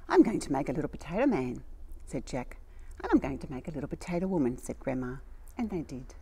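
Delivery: put-on gentle read-aloud storytelling for children; storybook reading. A narrator's reading. Reading a story to little children, storybook voice. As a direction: tell it like a bedtime picture book. Reads a picture book aloud.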